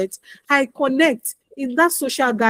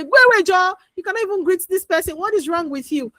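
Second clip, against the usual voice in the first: harsh scolding; 7 semitones higher than usual. Retold or imitated. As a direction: snap at the child in exasperation. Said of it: "Don't give them one bad abara".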